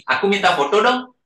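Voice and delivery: normal talking voice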